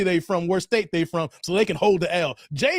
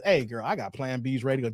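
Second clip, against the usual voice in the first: low, quick, softer mock come-on; smooth-talker act; 9 semitones lower, 7 dB quieter, faster than usual. Man talking to a girl about Plan Bs, mock come-on. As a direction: play a man dropping his pitch low.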